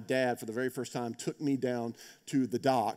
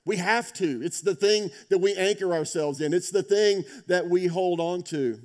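louder, higher, urging the congregation to hold on; preaching at full force; 6 semitones higher, 7 dB louder than usual. Acted. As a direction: preach it out with rising conviction. Opening exhortation to the congregation.

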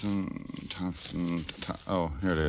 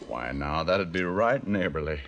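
put-on Arkansas country accent. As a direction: play the dumb Arkansas farm boy, pitched up. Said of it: "How about a Swedish dialect?".

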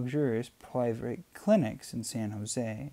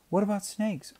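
worried; 10 semitones higher, 3 dB louder than usual. A narrator's reading. Worried mother on beach.

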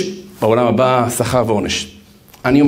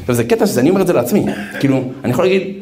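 ordinary talk

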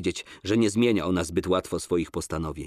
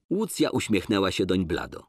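plain reading voice

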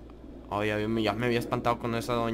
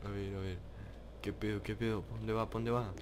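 much softer, low hushed voice; 4 semitones lower, 12 dB quieter than usual. Real, not acted. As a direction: keep it hushed, hiding. Hiding from the killer.

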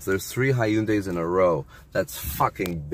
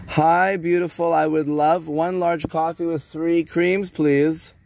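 much higher, cheery ordering voice; friendly customer voice; 11 semitones higher, 4 dB louder than usual. Real, not acted. Customer ordering coffee from a drive-thru worker, high friendly voice. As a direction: lift the voice sweetly for a stranger.

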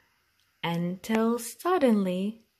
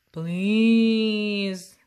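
begging bear; 5 dB louder than usual. Acted.

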